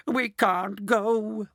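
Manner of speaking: very slow, high mock wail. A narrator's reading. Grandma Georgina giving up on the trip, slow high wail. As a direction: drag it out in mild despair. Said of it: "wailed Grandma Georgina".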